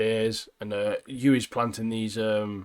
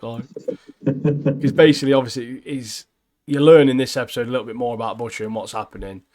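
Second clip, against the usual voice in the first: normal talking voice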